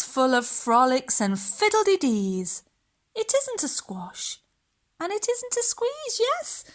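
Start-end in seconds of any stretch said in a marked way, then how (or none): 3.1-6.6 high, soft sing-song; sing-song refrain. A narrator's reading. Storyteller chanting the happy refrain to children, high and soft. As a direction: lilt it up for the children.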